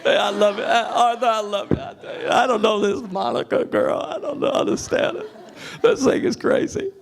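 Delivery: mock Clinton impression, high and laughing; playful Clinton voice, breaking into laughs. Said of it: "I'm talking like Clinton now".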